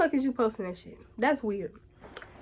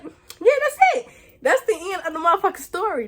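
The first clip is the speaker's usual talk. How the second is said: emphatic wrap-up; 5 semitones higher than usual. Real, not acted. Wrapping up story.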